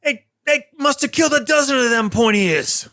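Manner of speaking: high, put-on Cockney-ish "Oi" barfly; rowdy local drunk. Drunk sailor pointing out the hero to the crowd, rowdy high yell. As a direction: shout it like a tipsy sailor. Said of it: "familiar voice goes".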